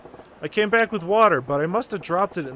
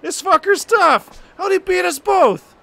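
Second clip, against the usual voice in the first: much higher, louder shouted disbelief; thug shouting; 12 semitones higher, 7 dB louder than usual. Acted. Thug yelling in disbelief after losing the fight. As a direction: yell it like a beaten thug. Voicing the beaten thugs.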